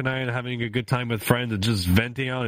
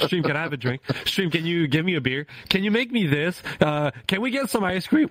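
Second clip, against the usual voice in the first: higher-pitched mock husband making requests; imitating husband; 7 semitones higher than usual. Streamer mimicking her husband's requests, mock pleading. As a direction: play a needy husband, pitched up. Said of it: "'Cause my husband's like".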